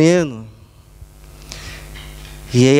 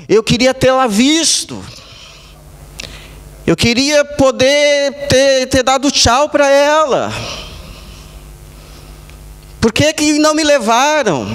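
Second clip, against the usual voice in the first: retold anguished cry; 6 semitones higher than usual. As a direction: mildly act out a pleading outcry, pitch lifted. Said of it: "gritando e gritando". (imitando um grito angustiado, sem gritar de fato)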